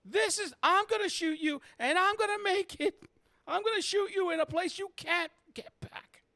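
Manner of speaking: very high, loud falsetto; high-pitched hysterical tirade; furious girlfriend threatening. Acted. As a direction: shriek a ranting threat. Abby's furious threat, acted by GM.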